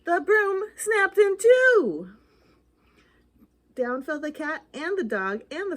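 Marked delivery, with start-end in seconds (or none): 0.0-2.1 slow, high, loud dramatic reveal; dramatic snap. A narrator's reading. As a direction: draw out the crash for the kids. Dramatic climax of story.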